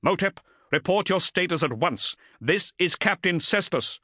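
stern voice over the vox; a captain demanding a report; commanding captain voice. Acted.

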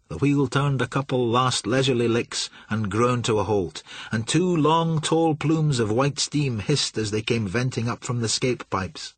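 usual speaking voice

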